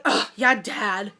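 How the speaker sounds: imitating a scornful daughter; mock exasperated brat; spoiled brat scoff. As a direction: scoff it like a bratty kid. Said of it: "are you a sixth grade spoiled brat".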